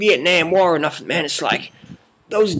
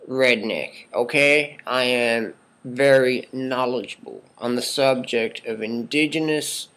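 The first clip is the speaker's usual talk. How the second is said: ordinary delivery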